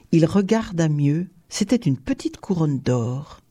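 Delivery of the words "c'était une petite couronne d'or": plain reading voice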